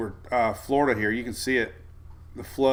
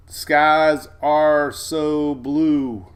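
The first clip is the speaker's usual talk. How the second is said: very slow, stretched-out words; dreamy drawl; 4 dB louder, much slower than usual. Real, not acted. Weatherman gushing to a viewer about Alabama, dreamy and slow. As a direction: linger on each word like a daydream.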